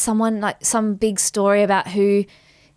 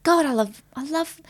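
high, fast gushing at first; imitating gushing friend; 5 semitones higher than usual. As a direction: gush over every little thing. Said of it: "her friend's like".

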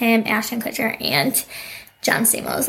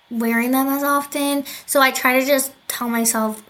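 ordinary delivery

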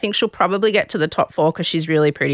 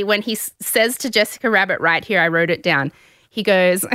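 ordinary talk

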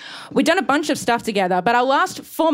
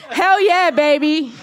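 louder, higher whoop of delight; cheering hell yeah; 5 semitones higher, 4 dB louder, slower than usual. Real, not acted. Comic answering the audience's shout, gleeful cheer. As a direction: cheer it back at the crowd.

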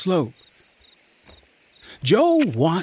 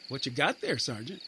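much softer, a voice from the dark; distant soldier; 13 dB quieter, faster than usual. A narrator's reading. Soldier calling from the dark to the sergeant, softly. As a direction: call over quietly from the shadows.

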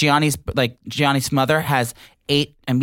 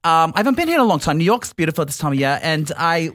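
put-on British accent. Guest on the show in character: British accent. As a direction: play a British guest visiting New York. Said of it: "it's so good".

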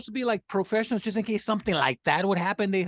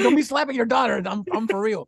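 mock reprimand; 5 semitones higher, 4 dB louder than usual. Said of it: "it's like".

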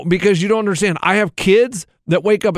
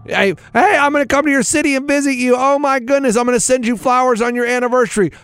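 much higher, gushing friend voice; high sweet mock friend; gushing new friend; 7 semitones higher than usual. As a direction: gush like an overeager new pal. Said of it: "You're gonna be".

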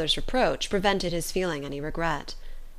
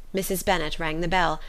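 ordinary delivery

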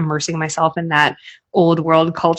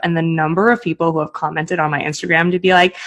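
ordinary delivery

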